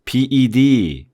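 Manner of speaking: 비이디 letter by letter, slow; spelled slowly. Shown as a demonstration. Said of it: "한번 해보죠". (천천히 또박또박 비이디)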